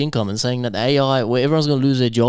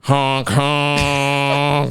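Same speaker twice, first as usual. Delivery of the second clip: silly clown; 2 semitones higher than usual. Pretend. Sign-off joke.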